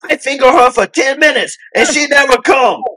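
high, loud mock Indian accent; imitating Indian accent. Passenger repeating himself to Carrie in a high, loud mock Indian accent. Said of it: "He goes".

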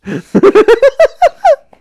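high, loud cackling laugh; shrieking laugh. Real, not acted. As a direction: burst into a shrill laugh. A huge burst of laughter.

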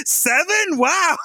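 high-pitched incredulous "seven?", then wow; shocked scammer reaction. Retold or imitated. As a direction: react in disbelief, barely holding back giggles. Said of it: "the scammer's reaction to".

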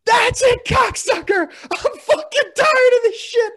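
screaming at the top of his voice, high and loud; enraged scream. Retold or imitated. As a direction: blow up and scream in fury. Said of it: "and I was like".

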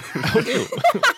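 mock tiger growl copied back; imitating a tiger noise. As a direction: copy the tiger noise you just heard. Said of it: "It was me imitating your tiger noise".

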